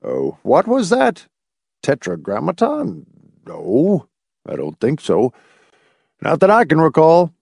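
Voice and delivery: searching the memory doubtfully; puzzled. A narrator's reading. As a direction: mull it over, bemused. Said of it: "Doctor Tillingford looked puzzled".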